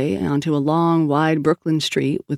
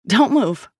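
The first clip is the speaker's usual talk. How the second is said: mild shout; 6 semitones higher than usual. A narrator's reading. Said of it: "Sal shouted".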